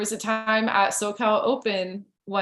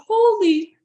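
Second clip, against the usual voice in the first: "Holy." shot out much higher and louder; shocked exclamation; 11 semitones higher, 7 dB louder than usual. Retold or imitated. Reliving the award call on stage, "Holy." a high loud yelp. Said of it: "in that moment, I was like".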